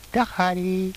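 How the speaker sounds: high, sing-song little greeting; mock greeting. Girl greeting Harry in the story, high put-on voice.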